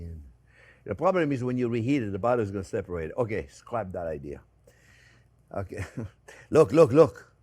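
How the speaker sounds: usual speaking voice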